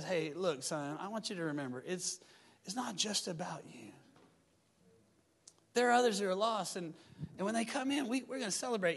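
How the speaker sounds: soft, hushed father talking to his son; gentle fatherly voice. Acted. Father quietly reminding his older son, soft and tender. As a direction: lean in and tell him gently.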